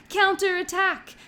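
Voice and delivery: slow, high, drawn-out shout; opposing battle cry. A narrator's reading. Children shout back from the other side of the field, slow and loud. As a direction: call the charge back across the field. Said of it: "opposing shout".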